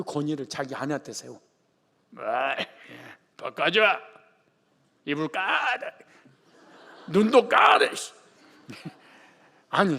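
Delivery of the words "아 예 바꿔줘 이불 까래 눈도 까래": domineering husband. Retold or imitated. Husband bossing wife via phone.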